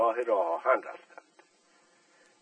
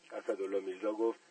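ordinary delivery

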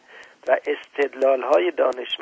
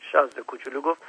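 ordinary delivery, nothing marked

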